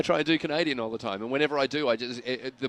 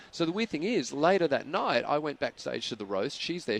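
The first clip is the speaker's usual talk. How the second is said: normal talking voice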